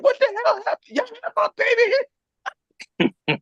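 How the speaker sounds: high, slow falsetto of the shocked wife; shocked wife impression. Wife finding them with the baby, high and slow. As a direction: squeal it way up high, drawn out slow. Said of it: "She's like".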